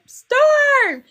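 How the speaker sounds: calling the name high and loud; a high loud call of her friend's name. Retold or imitated. Calling her friend Storm, loud and high. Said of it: "I'd be like".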